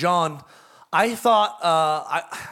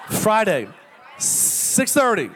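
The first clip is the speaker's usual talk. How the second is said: slow emphatic correction; 3 semitones higher, much slower than usual. Shown as a demonstration. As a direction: spell out "six thirty" slowly so it sticks. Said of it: "That was a test".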